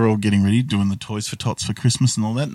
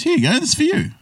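much higher, sing-song mock dad voice; sweet eccentric dad; faster than usual. Kooky father giving a stranger a gift, high sing-song. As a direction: hand it over like a cheerful oddball old man.

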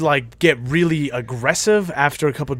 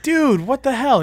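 outraged disbelief; 10 semitones higher, 3 dB louder than usual. Retold or imitated. Friend outraged at cops.

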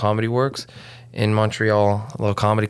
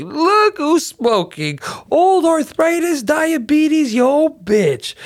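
mocking taunt; 5 dB louder, much slower than usual. Teasing mom about smoking.

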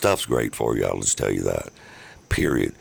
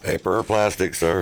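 higher, rote checkout clerk line; flat rote cashier; 7 semitones higher than usual. Pretend. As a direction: recite it like a bored bagger. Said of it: "Yeah, exactly".

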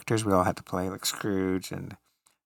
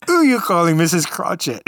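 louder and higher, mock-offended English accent; mock indignant English accent; 8 semitones higher, 9 dB louder than usual. Bob Cratchit objecting to the pastor in an English accent. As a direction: bristle in a hammed-up English accent. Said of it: "And I'm like".

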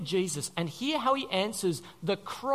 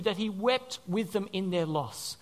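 ordinary talk

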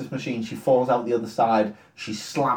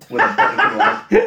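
high, laughing, breathy disbelief; 6 dB louder than usual. Real, not acted. Podcaster laughing at a plot hole, laughing high. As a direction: squeak it out through a laugh. Incredulous aside while laughing.

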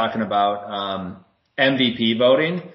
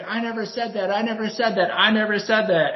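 high-pitched imitation of a shouting pundit; mock Perkins yelling; 10 semitones higher than usual. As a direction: play a pundit losing an argument, pitched up. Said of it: "He's just screaming".

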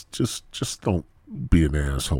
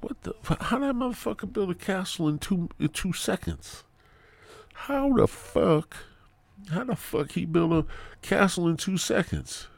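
retold disbelief; 12 semitones higher than usual. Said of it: "I'm, I'm like".

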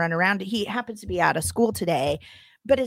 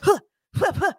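high loud mock grunting of a man lifting; workout grunts; 10 semitones higher, 3 dB louder than usual. To her co-host, imitating a neighbor's workout grunts, high and loud. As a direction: puff out fake exertion grunts, high and loud. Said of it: "you're hearing".

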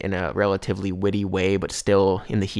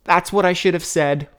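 higher and louder, an eureka burst; triumphant realization; 9 semitones higher, 6 dB louder than usual. Retold or imitated. George gloating to himself about the comeback, triumphant. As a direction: hit it like the perfect comeback finally arrived. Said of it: "And George thinks".

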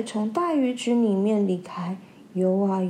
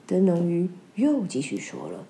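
ordinary delivery, nothing marked

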